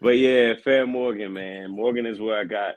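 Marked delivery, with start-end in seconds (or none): none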